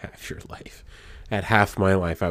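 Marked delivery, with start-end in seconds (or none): 0.0-0.8 whispered under the breath; shocked whisper. Real, not acted. Reacting to a friend's age, whispering in shock. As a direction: breathe it out in disbelief.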